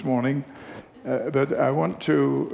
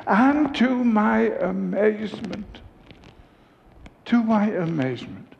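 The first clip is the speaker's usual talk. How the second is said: retold amazement; 9 semitones higher than usual. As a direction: marvel theatrically, pitched well up and slowed, then repeat it. Retelling her astonished discovery.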